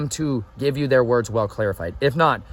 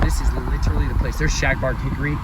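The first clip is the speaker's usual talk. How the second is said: ordinary talk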